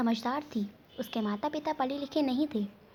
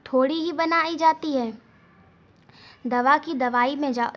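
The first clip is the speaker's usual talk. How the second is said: louder, rising annoyed retort; angry shopkeeper; 3 dB louder than usual. A narrator's reading. Shopkeeper snapping at the father over the returned medicine, louder and angry. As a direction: brush the customer off with irritation. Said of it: "दुकानदार ने गुस्से से कहा".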